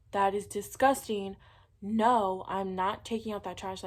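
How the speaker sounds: loud, emphatic, irritated refusal; retold angry screaming. Daughter refusing mom over bathroom trash, retold angry yell. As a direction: fire it off loudly, a retold outburst. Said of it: "I'm just screaming at my mom".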